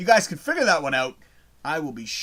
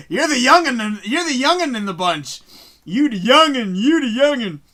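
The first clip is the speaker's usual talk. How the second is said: playful teasing; 7 semitones higher, 8 dB louder than usual. Real, not acted. Teasing young viewer.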